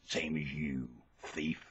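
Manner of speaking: low, soft accusing voice; soft, low-pitched jab; quiet accusation. Real, not acted. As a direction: say it low and under the volume. Quiet first take.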